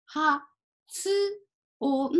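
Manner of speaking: 発音 sound by sound with the つ fully voiced as its own beat; fully sounded tsu. Shown as a demonstration. Teacher showing learners the big tsu, 発音 said in separate, fully sounded beats. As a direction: split 発音 into ha, tsu, o, n, each separate. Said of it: "発音してください".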